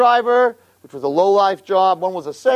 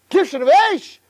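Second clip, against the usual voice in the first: normal talking voice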